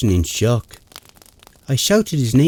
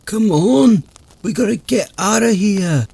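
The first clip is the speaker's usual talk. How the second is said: urgent fear; 8 semitones higher than usual. Acted. Fleeing unseen threat.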